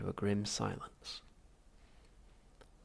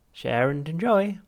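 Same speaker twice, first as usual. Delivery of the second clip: high, slow, loud cheery machine voice; chirpy machine; 7 semitones higher, 10 dB louder, slower than usual. Acted. Drink machine chirping its motto at Arthur, cheery machine voice. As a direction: sing it out like a relentlessly cheerful vending machine. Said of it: "the machine replied".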